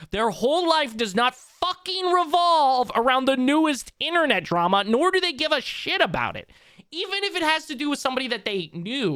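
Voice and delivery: high, loud, emphatic indignation; heated rant. Real, not acted. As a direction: hammer the point home, exasperated. Heated rant defending streamers' private lives.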